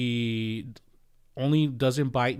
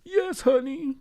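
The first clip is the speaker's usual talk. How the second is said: high, meek mock reply; a pitched-up, sheepish yes honey; 4 dB louder, slower than usual. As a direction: play the henpecked husband giving in. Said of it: "And I was like".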